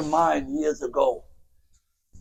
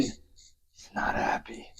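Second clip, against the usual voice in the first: slow hushed whisper; slow dramatic whisper; 14 dB quieter, slower than usual. Acted. Narrator revealing the hero's misery, slowly whispered. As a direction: drop to a grim whisper.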